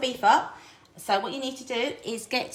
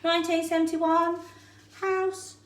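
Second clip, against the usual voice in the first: bingo caller; 7 semitones higher than usual. Pretend. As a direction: call the numbers like a bingo hall. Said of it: "I feel like I'm bingo caller here".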